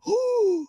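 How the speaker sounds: high imitation gasp; collective shocked gasp. Imitating diners getting the link, shocked gasp. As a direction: mimic strangers gasping at their phones.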